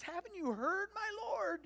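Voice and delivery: high, loud, acted plea; tearful pleading. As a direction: plead your case as if close to tears. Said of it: "hear the tears in his voice".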